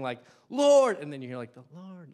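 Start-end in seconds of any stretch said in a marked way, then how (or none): 0.5-1.0 loud, high, wailing call to God; anguished cry. Retold or imitated. David alone in the cave, crying out loud. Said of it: "him saying". 1.5-2.1 soft, far-off echo of the cry; cave echo. Retold or imitated. The cave echoing David's cry, faint and distant. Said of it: "like, the echo of the cave".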